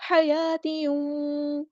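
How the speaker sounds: حیاتیوں with ghunna held one alif before و; slow nasalized merge in حیاتیوں; tajweed recitation. Shown as a demonstration. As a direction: hum the tanween into و, slowly. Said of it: "ایک الف کے برابر رکی ہوں غنہ کرتے ہوئے".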